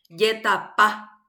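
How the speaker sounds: Yetapá said slowly, final "pá" stressed. Shown as a demonstration. Teacher showing the tonic vowel, slow Yetapá stressed at the end. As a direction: spell out Yetapá by syllable, landing on "pá". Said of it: "¿Cuál es la vocal tónica? Es la A".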